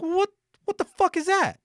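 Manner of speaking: exasperated high-pitched swearing at an absurd excuse; incredulous outrage. Retold or imitated. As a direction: pitch shooting way up in disbelief. Retold outrage at FedEx's excuse.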